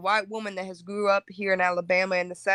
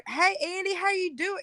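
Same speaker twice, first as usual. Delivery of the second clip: light, high-pitched hello; casual cookout hello, pitched way up; high friendly greeting; 10 semitones higher than usual. Acted.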